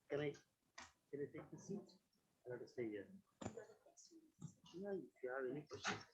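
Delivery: off mic, distant and faint; mic handed over. Real, not acted. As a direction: far from the microphone. Speaker leaves the podium mic.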